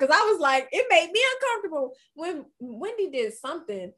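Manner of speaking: ordinary delivery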